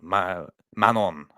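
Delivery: slow name in a French accent. Shown as a demonstration. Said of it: "in a French accent".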